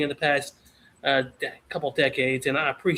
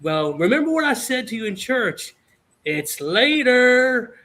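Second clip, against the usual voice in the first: imitating dad, higher, drawn-out last word; dad's ominous threat; 6 semitones higher, 4 dB louder than usual. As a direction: stretch it out like a looming parental warning.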